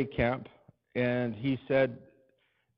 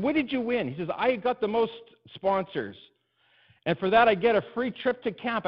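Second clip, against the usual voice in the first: higher, eager voice for Kenny; voicing Kenny, higher; 8 semitones higher, 4 dB louder than usual. Kenny telling Alan he won, in a higher eager voice. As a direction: let Kenny sound proud of his win.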